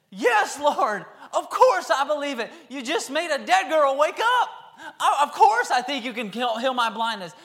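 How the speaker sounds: high, loud, laughing eagerness; excited answer. Shown as a demonstration. Healed blind men answering Jesus, loud and laughing. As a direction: answer like you just saw a miracle. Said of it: "I believe they said".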